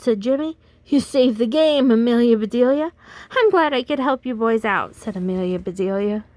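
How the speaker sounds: put-on lively read-aloud for kids; animated storyteller voice for children; storybook reading. A narrator's reading. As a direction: read it like bedtime story time. Reads picture book to children.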